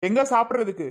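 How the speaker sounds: ordinary talk, nothing marked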